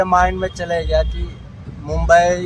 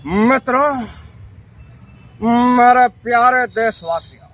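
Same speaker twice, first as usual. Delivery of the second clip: higher, slow orator's voice; political speech impression; 4 semitones higher than usual. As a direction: address the nation with grand pauses. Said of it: "फिर अगले".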